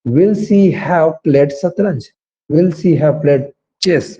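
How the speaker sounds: very slow question, word by word; slow dictation. Shown as a demonstration. Teacher slowly modelling the question form. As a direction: stretch each word for learners. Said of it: "तो कैसे बोलेंगे sentence को?".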